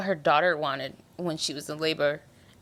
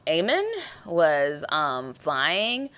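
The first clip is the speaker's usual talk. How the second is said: ordinary talk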